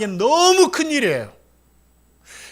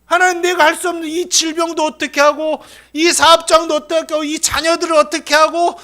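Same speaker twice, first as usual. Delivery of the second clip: higher, louder anguished plea to God; desperate lament; 7 semitones higher, 5 dB louder than usual. Acted. Preacher voicing a helpless believer, pleading in anguish. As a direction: cry out as if at the end of your rope. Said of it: "하고 내가 감당할 수 없으니까".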